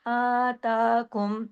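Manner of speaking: slow आताकुम with both aa vowels held; one-alif stretch. Shown as a demonstration. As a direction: draw out the आ and ता of आताकुम evenly. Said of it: "एक अलिफ के बराबर". (आताकुम, दोनों खड़ी जबर खींचकर)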